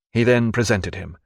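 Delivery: ordinary delivery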